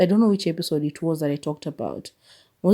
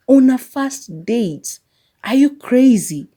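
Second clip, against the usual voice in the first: higher, louder outraged question; outraged disbelief; 7 semitones higher, 6 dB louder, slower than usual. Real, not acted. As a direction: demand it in outrage. Outraged at crying man.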